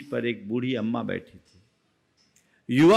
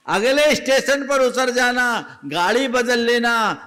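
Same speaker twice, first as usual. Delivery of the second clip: higher and louder, driving the advice home; old woman repeating her advice to the youth, higher and louder; emphatic advice; 11 semitones higher, 8 dB louder than usual. Retold or imitated. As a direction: repeat it forcefully to make him listen. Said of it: "कहा".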